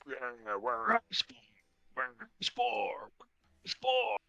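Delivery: hushed, airy mock shouting; stretched half-whispered crowd yell; distant crowd shouting. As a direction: imitate far-off fans chanting, drawn out and breathy. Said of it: "people shouting".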